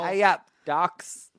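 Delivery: slow, drawn-out Yorkshire greeting; Yorkshire accent. Pretend. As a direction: stretch out a broad Yorkshire ay up. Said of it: "Strong Yorkshire accent required".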